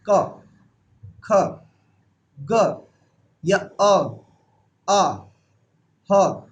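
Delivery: क, ख, ग, अ, आ, ह one by one, tongue root to the throat; throat sounds demonstrated. Shown as a demonstration. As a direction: sound out क, ख, ग, अ, आ, ह separately from the throat, with pauses. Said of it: "वो गले को टच होती है। जैसे आप बोलेंगे".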